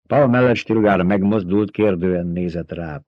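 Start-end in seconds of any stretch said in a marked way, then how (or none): none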